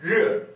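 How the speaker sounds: re hoch ansetzen, scharf fallend; fourth tone. Shown as a demonstration. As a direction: re kräftig abfallen lassen. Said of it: "fourth tone".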